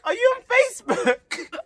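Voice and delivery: very high, loud mock-excited voice; teacher's eager question. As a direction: squeal the question as a hammed-up imitation. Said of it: "And then she's like".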